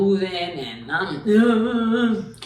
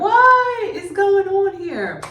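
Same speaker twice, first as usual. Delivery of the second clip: much higher, louder, very slow bewilderment; confused; 8 dB louder, much slower than usual. Retold or imitated. On stage, confused by the laughing crowd, slow high bewilderment. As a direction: stretch it out, totally lost. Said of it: "And I was like".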